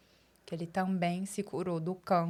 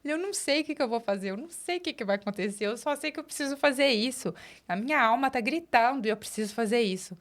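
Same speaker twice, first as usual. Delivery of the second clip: high, hurried, overwrought retelling; mock tearful; 5 semitones higher than usual. Employee insisting to boss she must leave, mock tearful. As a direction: imitate pleading through tears, pitch climbing. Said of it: "Eu começava a chorar, chorar, chorar".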